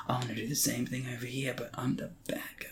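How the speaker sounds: hushed, sneaky whisper, a touch fast; mock actor whisper. Imitating the actor whispering about playing the bad guy. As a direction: whisper it like a guilty secret. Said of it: "and Daniel's like".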